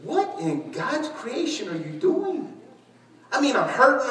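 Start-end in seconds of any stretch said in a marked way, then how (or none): 0.0-2.5 high, slow, soft mock alarm; mock-startled question; retold wife's alarm. Wife finds husband crawling, high slow mock alarm. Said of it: "and I'm going".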